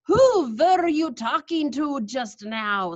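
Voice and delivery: slow, loud sharp question; snapped. Acted. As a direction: snap it suspiciously. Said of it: "snapped the Grand High Witch".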